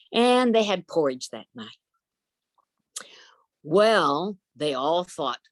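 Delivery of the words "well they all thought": ordinary delivery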